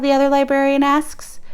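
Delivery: ordinary delivery, nothing marked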